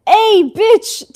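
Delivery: loud, high playful hail; calling out. A narrator's reading. Felix greets the guy across the aisle, calling out. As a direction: call it out cheekily across the room.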